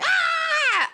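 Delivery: high shriek of fright; retold high scream; startled scream. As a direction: scream like you've been jumped on. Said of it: "right on the end of the scream".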